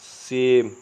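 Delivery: सेम said slowly and carefully, vowel like "ay"; model pronunciation. Shown as a demonstration. Teacher modelling सेम for students, careful and clear. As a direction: say सेम as a clean "same". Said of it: "सेम का उच्चारण होगा सेम".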